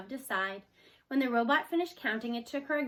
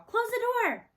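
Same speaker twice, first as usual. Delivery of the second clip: put-on high little girl voice; 12 semitones higher, 5 dB louder than usual. A narrator's reading. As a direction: say it like a young girl.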